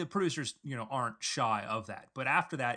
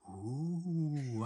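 awed crowd; 4 dB quieter than usual. Acted. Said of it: "you get the audience just like this".

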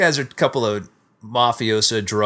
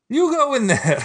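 high, mock panicked plea; panicked Italian; 11 semitones higher than usual. Scared cop urging the American, high pitched. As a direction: push him toward the door in a fright. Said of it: "He goes".

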